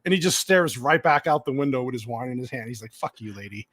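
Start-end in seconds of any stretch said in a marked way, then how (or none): 2.9-3.7 low, hushed inner-thought voice; muttered resentful aside. Retold or imitated. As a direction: mutter it softly to yourself, imitating silent resentment. Silent resentful thought while staring away.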